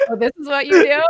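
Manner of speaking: high, loud mock husband, laughing through it; laughing husband imitation. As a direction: play the baffled husband while cracking up. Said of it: "he's like".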